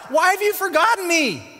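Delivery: high, loud anguished cry; calling out to God. Acted. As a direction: cry it out to heaven. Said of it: "he's calling out to God".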